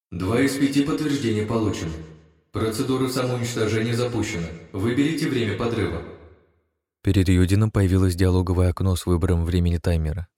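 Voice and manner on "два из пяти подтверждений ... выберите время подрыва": disembodied voice. Acted. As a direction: speak as the ship's AI. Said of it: "голос продолжил".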